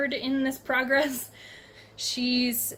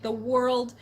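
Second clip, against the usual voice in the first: normal talking voice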